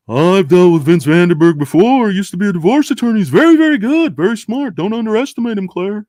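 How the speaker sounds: bad TV prosecutor impression, pitched high; Ben Stone. Prosecutor warns his assistant about a rival, hammed-up high voice. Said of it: "horrible Ben Stone impression".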